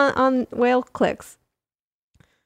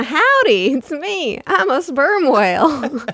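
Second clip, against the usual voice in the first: put-on southern whale accent; 5 semitones higher, 4 dB louder than usual. Whale introducing itself to listeners, mock Southern accent. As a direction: play a sperm whale greeting folks in a Southern accent. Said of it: "imagine all sperm whales as having a southern accent".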